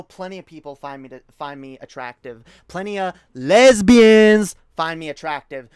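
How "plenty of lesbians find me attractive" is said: very loud, high yelled comeback; loud retort. Real, not acted. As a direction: blast it back at him. Yelling retort at clip.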